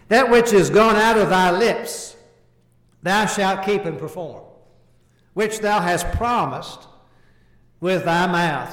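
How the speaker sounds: slow, weighty reading of the verse; scripture reading. Acted. Preacher to congregation, slow solemn scripture reading. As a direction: read the scripture slowly, giving each phrase weight.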